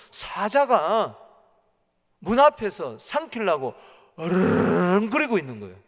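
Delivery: loud preaching with a rolled growl; growling lion imitation. Preacher shows lion waiting at the door, growling imitation. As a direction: roll out a long, loud predator's growl.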